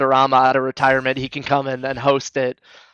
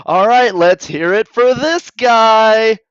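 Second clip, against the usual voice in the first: loud, high, drawn-out emcee call; hype host; 7 semitones higher, 6 dB louder, much slower than usual. Acted. Host calling an applause vote, hyped-up shout. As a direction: whip up the crowd, stretching the words.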